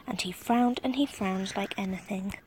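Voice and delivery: plain reading voice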